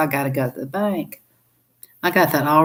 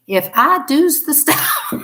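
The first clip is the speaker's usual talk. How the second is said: laughing; 9 semitones higher, slower than usual. Real, not acted. Laughing protest about credit.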